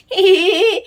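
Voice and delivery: high little burst of laughter; fond laugh. Acted. Mother laughing with her baby.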